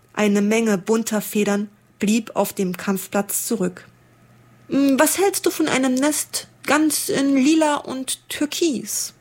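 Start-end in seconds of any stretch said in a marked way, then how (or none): 4.7-9.1 higher, tentative suggestion; young blackbird asking his mate about a nest. Acted. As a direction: sound out your sweetheart gently. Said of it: "fragte ein Amseljüngling seine Gefährtin".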